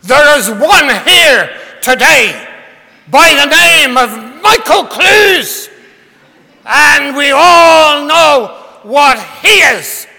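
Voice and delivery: booming Northern Irish preacher shout, high and slow; Ian Paisley impression. Preacher mocking a man named Michael from the pulpit, booming shout. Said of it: "I used to be able to do, a good Ian Paisley".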